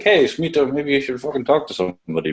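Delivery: a mock tough-love push, tossed off lightly; blunt advice. Imagined telling the client to get help, mock blunt. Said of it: "I feel like I'd be like".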